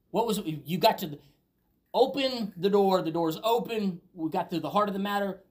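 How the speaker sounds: fervent preacher's emphasis; preaching. Acted. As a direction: lean in and drive it home. Sermon climax, louder and slower.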